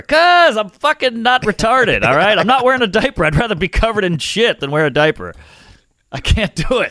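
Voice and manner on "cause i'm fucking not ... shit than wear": indignant. Real, not acted. Heated rant about diapers.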